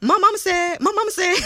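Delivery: loud, high country "mah mama"; imitated country accent. Coworker mimicking her on the phone, exaggerated country accent. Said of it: "when you're on the phone, you always like".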